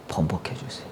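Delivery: low whispered begging; whispered plea. Acted. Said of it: "통곡하면서 기도한 거예요". (속삭이며 애원하는 기도)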